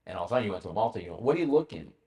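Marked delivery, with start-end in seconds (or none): none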